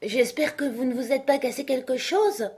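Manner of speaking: high, mildly worried question; anxious voice. A narrator's reading. Alice after the knight's fall: mildly anxious. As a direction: ask with mild alarm. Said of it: "d'une voix anxieuse".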